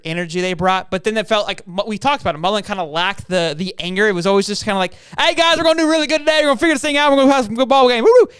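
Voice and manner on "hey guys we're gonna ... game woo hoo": high, loud, relentlessly peppy coach; mock over-happy coach, fast start; too happy. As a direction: chirp a hammed-up pep talk. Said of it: "It was just too happy all the time".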